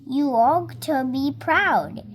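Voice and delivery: ordinary delivery, nothing marked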